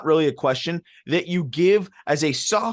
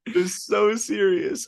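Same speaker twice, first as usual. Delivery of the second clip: laughing; 6 semitones higher, much slower than usual. Real, not acted. Laughing at the deadpan.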